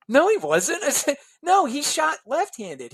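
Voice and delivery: arguing hockey trivia with a former player; insistent correction. Retold or imitated. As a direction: put your foot down on the fact. Arguing with Bill about hockey.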